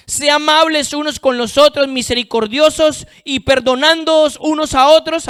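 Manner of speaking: high, emphatic scripture reading; lectura bíblica predicada. Real, not acted. Lee un versículo predicando. (versículo leído con fuerza)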